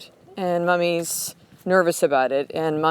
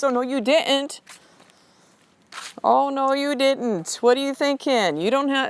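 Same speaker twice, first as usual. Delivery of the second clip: higher, drawn-out sassy disapproval; discouraging devil; 6 semitones higher than usual. Acted. Devil on the shoulder talking her out of it, sassy scolding. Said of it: "then I got the devil going".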